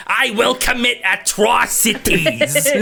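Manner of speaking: high, slow, strained voice; near Gilbert Gottfried. Acted. Game master nearly slipping into a Gottfried voice. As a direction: squeeze it out like Gottfried. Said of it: "close to slipping into Gilbert Gottfried".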